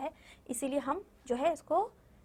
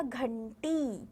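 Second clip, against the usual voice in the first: slow; much slower than usual. Shown as a demonstration. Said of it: "तो क्या बन गया?".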